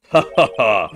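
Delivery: mock villain laugh.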